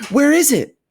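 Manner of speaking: high, loud crowd shout. A narrator's reading. Someone in the crowd shouting to see the ticket, high and loud. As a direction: call out over the crowd. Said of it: "somebody shouted".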